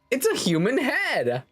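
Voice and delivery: high-pitched exclamation of discovery; mock shock. Player reacting to a head in the sand, mock shock. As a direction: blurt it way up high.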